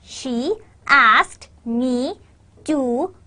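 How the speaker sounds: very slow, word by word, ASKED and GO stressed; slow stressed syllables. Shown as a demonstration. Teacher breaking down the stress for students, slow and word by word. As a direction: spell out the rhythm slowly, pausing between words. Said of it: "your stress is on asked and go".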